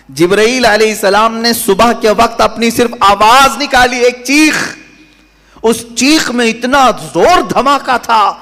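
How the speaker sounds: high, emphatic sermon climax; full-force preaching. Real, not acted. Preacher tells of the angel's deadly cry, full-force preaching.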